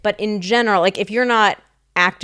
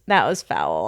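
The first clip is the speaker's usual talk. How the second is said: normal talking voice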